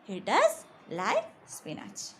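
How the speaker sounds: does said slowly and pressed; slow and hit does; stress on does. Shown as a demonstration. Teacher showing students emphatic does, slow stress on does. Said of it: "We are stressing there and saying".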